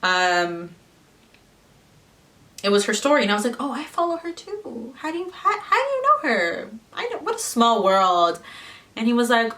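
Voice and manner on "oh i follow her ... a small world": high, laughing mock surprise; fake surprise. Quoting herself to her boyfriend with high laughing surprise. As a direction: act overly surprised with a giggle.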